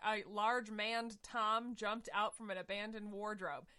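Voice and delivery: usual speaking voice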